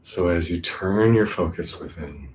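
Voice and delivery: calm meditation voice with long, settling pauses; guided meditation. Acted. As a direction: guide them inward, unhurried.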